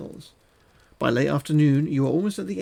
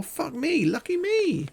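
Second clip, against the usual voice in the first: delighted squeal; 13 semitones higher, slower than usual. Real, not acted. Gamer pleased by magic smoke, high excited squeal. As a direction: voice shooting way up in glee.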